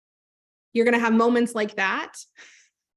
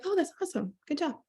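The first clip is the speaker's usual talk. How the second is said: soft, higher, politely dismissive; underwhelmed praise; 6 semitones higher, 7 dB quieter, faster than usual. Retold or imitated. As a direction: imitate a mildly interested caregiver. Caregivers' brief, unimpressed praise retold.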